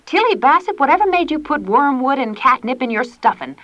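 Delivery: high, loud reproach; demanding. A narrator's reading. Ma demanding why Tilly used wormwood. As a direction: call her to account. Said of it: "demanded Ma".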